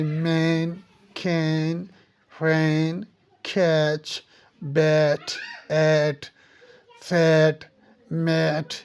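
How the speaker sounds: मैन, कैन, कैच, बैट with an open ae; blended aa-e vowel. Shown as a demonstration. Teacher demonstrating a blended sound, open ae in मैन, बैट, मैट. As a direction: blend aa and e in each word, मैन to मैट, one at a time. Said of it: "आ और ए sound को मिला के".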